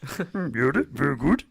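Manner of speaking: lightly voiced Yoda; a half-hearted Yoda impression; badly faked little green sage; Yoda attempt. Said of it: "No, that's not Yoda".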